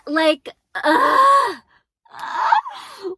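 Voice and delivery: loud exasperated ugh, squeaking high; high strangled ugh of exasperation; frustrated groan. Real, not acted.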